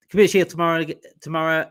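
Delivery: usual speaking voice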